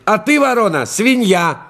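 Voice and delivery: high mocking insult; fox shouting insult. A narrator's reading. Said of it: "А лиса вороне кричит".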